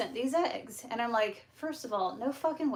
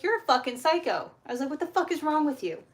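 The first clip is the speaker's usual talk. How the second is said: higher, louder indignant comeback; retold angry retort; 6 semitones higher, 4 dB louder, faster than usual. Seller's accuser retells firing back, indignant. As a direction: fire back an insult, half-acted.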